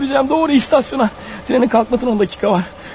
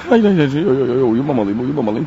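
sliding much lower, fighting to stay awake; drowsy fading protest; 11 semitones lower than usual. Acted. Wounded killer at the wheel, struggling not to pass out. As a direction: let the words sink as sleep pulls him under. Bleeding man fighting off sleep.

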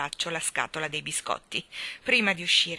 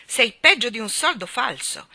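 loud, raised-pitch scorn; scornful scolding, pitched up; disgusted; 5 semitones higher, 10 dB louder than usual. Acted. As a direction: throw the insult out with loud disgust. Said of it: "arricciò il naso con disgusto".

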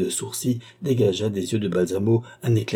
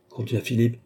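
plain reading voice

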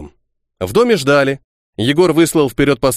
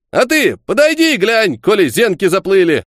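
scoffing; 7 semitones higher than usual. Acted. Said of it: "хмыкнул Кухарев".

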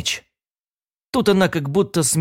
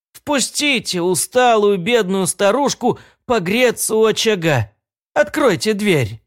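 put-on high, weak pleading old woman; a frail old lady begging at the door; trembling old woman; 6 semitones higher than usual. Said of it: "слабый, дрожащий голос".

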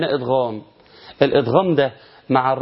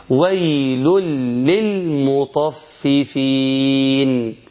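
slow chanted "ويل للمطففين" with a long final vowel; measured tajweed chant, drawing out "للمطففين"; Quran recitation; much slower than usual. Shown as a demonstration. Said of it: "ناخد مثال كمان". (تلاوة مجودة متأنية)

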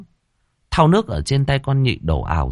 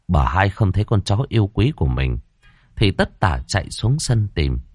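plain reading voice

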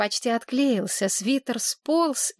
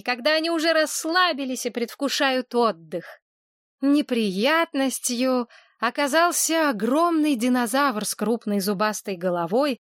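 ordinary delivery

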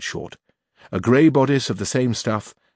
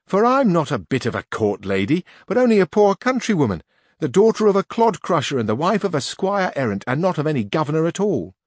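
Teresa; 6 semitones higher, 3 dB louder than usual. A narrator's reading. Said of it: "said Teresa".

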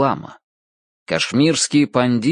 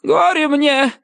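wailing lament. A narrator's reading. Magician keeps lamenting.

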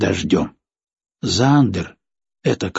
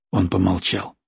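plain reading voice

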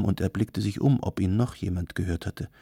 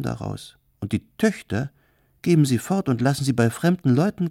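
high, loud, animated gossip; theatrical hostess, storytelling; 6 semitones higher, 5 dB louder than usual. Acted. Kardelin sells the client an orphan story, theatrical and animated. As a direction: spin the tall tale with relish.

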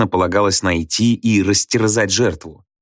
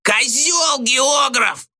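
very high, drawn-out shouted insult; Gradusov yelling; much slower than usual. A narrator's reading. Thrown-out pupil insults teacher from corridor, yelling. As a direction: holler it slowly at the top of the voice. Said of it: "заорал Градусов оттуда".

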